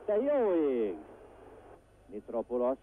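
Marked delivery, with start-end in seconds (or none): 0.0-1.0 surprised. Real, not acted. Gasp at near miss.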